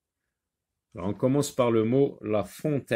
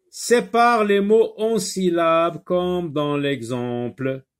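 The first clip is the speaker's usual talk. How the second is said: instruction read aloud; 5 semitones higher, 6 dB louder, slower than usual. Shown as a demonstration. Reading exercise instruction aloud.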